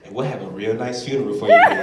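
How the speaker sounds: mother coolly brushing off her dramatic son, lightly voiced; mom deadpan. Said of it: "She say".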